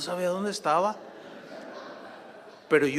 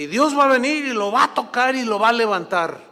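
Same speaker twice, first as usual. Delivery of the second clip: loud, higher faith proclamation; retold bold declaration; 5 semitones higher, 7 dB louder than usual. Preacher promising the sick man healing, bold proclamation. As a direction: proclaim it with building force.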